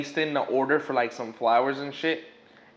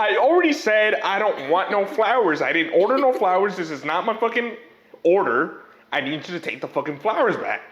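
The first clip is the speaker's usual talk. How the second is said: loud, higher, irritated rant; angry mom; 4 semitones higher, 3 dB louder than usual. Retold or imitated. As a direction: refuse the flowers, fed up. Angry mom refusing flowers.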